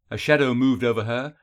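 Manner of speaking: ordinary delivery, nothing marked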